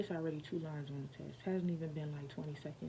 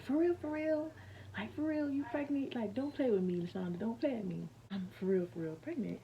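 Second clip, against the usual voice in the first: high, loud, overjoyed disbelief; excited boyfriend; 6 semitones higher, 5 dB louder than usual. Retold or imitated. Boyfriend jumps out of bed, thrilled and pleading. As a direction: bounce up thrilled, pressing her for the truth. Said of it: "he jumped up out the bed".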